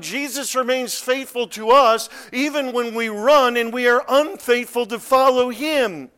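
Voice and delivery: high, loud, sustained sermon climax; full-force preaching. Real, not acted. Preacher proclaiming Jesus remains faithful to us, full-force preaching. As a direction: proclaim it hard, leaning on each key word.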